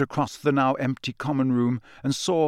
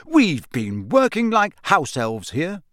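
mock outrage; 5 semitones higher, 4 dB louder than usual. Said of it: "said Ron in mock outrage".

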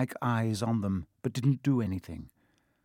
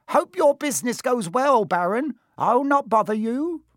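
high, loud ingratiating imp; obsequious poltergeist; 12 semitones higher, 6 dB louder than usual. Acted. Peeves takes his leave of the Baron, high fawning voice. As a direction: fawn brightly in a very high voice.

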